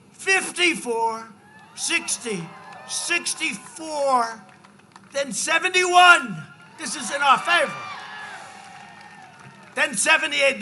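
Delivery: building rally shout; excited shout. Real, not acted. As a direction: stretch each number out for the crowd. Rally counting up the odds.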